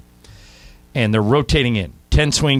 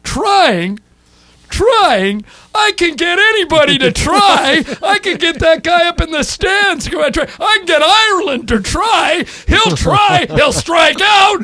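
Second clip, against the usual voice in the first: a much higher, louder mocking rant with the end slowed down; imitating Lasorda's furious shout; 11 dB louder, slower than usual. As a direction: shout the word trying back at him in mock outrage. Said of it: "Lasorda goes".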